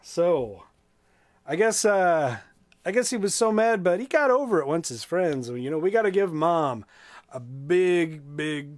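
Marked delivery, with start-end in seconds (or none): none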